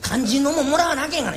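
ordinary delivery

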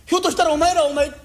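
yelling at his own fist as it lifts; alarmed shout. Acted.

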